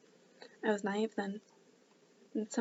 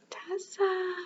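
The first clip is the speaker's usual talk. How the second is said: a mother calling up the stairs, high; 10 semitones higher than usual. Acted. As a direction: call Tessa! up to her daughter. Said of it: "she calls again".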